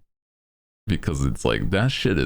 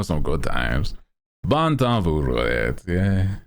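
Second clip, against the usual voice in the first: playful put-on Cajun accent, higher; 6 semitones higher than usual. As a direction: ham up a Cajun fisherman voice. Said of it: "That's, that's Cajun right there".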